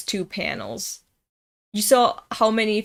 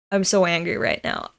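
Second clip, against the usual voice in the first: ordinary talk, nothing marked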